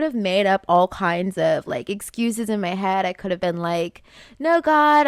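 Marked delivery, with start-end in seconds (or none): 4.4-5.1 mock protest. Mock excuse to God.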